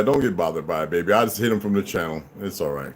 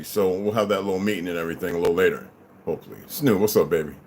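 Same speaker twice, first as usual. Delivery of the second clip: normal talking voice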